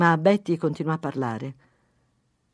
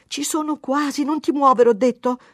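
high, louder insistence; pitched-up, impatient order to hold still; insistent; 10 semitones higher, 7 dB louder than usual. A narrator's reading. Wife almost done squeezing his blackhead, insistent.

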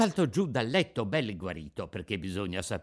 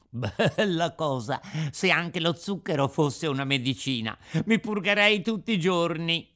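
put-on higher, lively puppet boy; Pinocchio, delighted puppet child; 6 semitones higher, 4 dB louder than usual. Pinocchio to the Fairy after eating sugar, delighted. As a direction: play an eager little puppet, slightly higher.

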